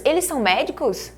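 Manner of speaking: ordinary delivery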